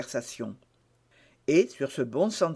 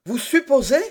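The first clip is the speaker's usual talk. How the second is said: high, louder offended question; indignant cry; 7 semitones higher, 5 dB louder than usual. A narrator's reading. D'Artagnan challenges Aramis's insinuation, high and louder. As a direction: throw the question back, affronted. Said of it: "s'écria D'Artagnan".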